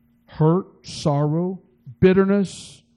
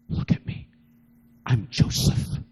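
whisper; 5 semitones lower than usual. Acted. Joseph reveals himself, weeping.

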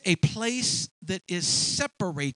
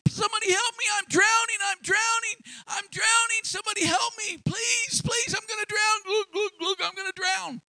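high, shouting, panicked drowning man; non-swimmer yelling from the pool, panicking; panicked cry for help; 3 dB louder than usual. Retold or imitated. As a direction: scream for help like he's going under. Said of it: "And he's going".

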